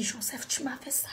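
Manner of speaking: hushed, broken whisper of betrayal; betrayed whisper to the man; whispered reproach. Retold or imitated. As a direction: whisper the accusation, devastated. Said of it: "J'ai dit".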